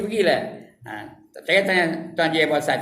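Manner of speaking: ordinary delivery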